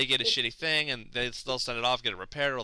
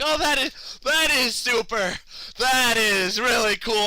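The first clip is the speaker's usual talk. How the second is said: high, loud and slow strained approval; forced praise; 10 semitones higher, 5 dB louder, slower than usual. Retold or imitated. Praising the clerk's unlocks while panicking, high strained approval. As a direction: push out fake enthusiasm through panic.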